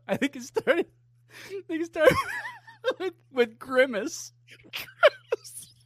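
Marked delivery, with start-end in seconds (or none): 4.7-5.7 laughing. Real, not acted. Laughing mock announcement of Grimace.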